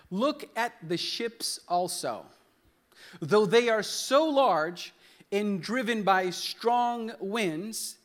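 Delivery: slow, emphatic reading from the pulpit; scripture reading. Real, not acted. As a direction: proclaim the verse slowly, phrase by phrase. Reads scripture aloud.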